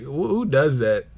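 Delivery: ordinary delivery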